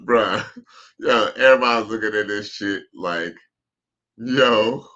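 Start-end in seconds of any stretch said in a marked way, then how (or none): none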